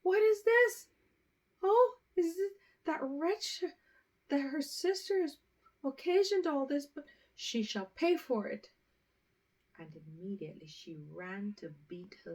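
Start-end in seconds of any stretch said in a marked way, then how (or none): 0.0-8.7 much higher and louder, raging; furious cry. A narrator's reading. A mother blaming the good sister for the curse, furious loud cry. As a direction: blame the absent sister in a fury. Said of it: "cried the mother".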